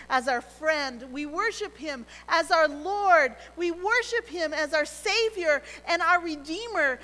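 sermon rising then softening; preaching. Acted. Preaching build then hush.

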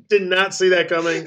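said through laughter; laughing. Real, not acted. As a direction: talk while still laughing. Laughing after punchline.

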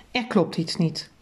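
ordinary delivery, nothing marked

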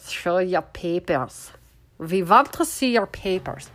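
put-on slow, hammy German accent; bad German accent. Host mocks officials demanding papers, in a poor German accent. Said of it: "say that in a German accent".

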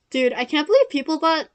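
ordinary talk, nothing marked

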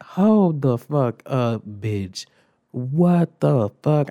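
very slow, loud disbelief; stunned indignation. Retold or imitated. Student wrongly called out in class, stunned indignation. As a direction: drag out each word in mock shock. Acted shock at being named.

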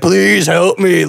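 slow, drawn-out plea; mock struggle to get the words out; sad creature pleading. Fan imitates sad Man Bull begging for help, slow labored plea. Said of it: "he can't really talk".

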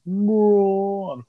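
Braun shouted high and stretched. Shown as a demonstration. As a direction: belt out Braun like a ring announcer. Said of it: "I'm gonna say it again".

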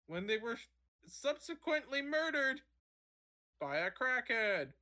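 high-pitched parody of a TV reporter; hammed-up field reporter delivery; sideline reporter. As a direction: overdo the on-the-scene report, punching mid-sentence words. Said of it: "that's how every sideline reporter fucking talks".

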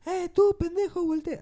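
high calling out across the stands; mock fan call. Fan in the stadium calling to someone, high mock shout. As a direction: shout for someone to turn around.

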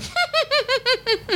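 high witchy cackle; Flintstones cartoon laugh. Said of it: "a Betty and Wilma from the Flintstones laugh".

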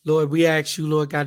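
ordinary talk

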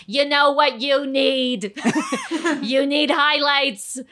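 put-on slow, drawn-out Long Island accent; quirky hairdresser with a Long Island accent. Actress unprompted in her hairdresser audition, Long Island accent. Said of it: "like, a Long Island accent".

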